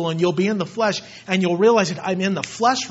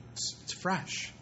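soft unvoiced whisper of fresh; hushed reverent whisper of fresh; 13 dB quieter than usual. Real, not acted. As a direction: breathe the word out.

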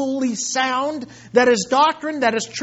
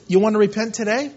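gentle fatherly invitation; 6 semitones lower than usual. Retold or imitated. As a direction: invite them in like a loving father. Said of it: "God the Father says".

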